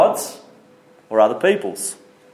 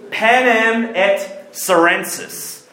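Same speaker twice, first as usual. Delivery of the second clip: slow, raised, showy Latin pronunciation; attempted Latin accent; 5 semitones higher, much slower than usual. Shown as a demonstration. Preacher showing off some Latin to the congregation, attempted Latin accent. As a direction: announce it grandly in an unsure Latin accent. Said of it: "if I got the accent correct".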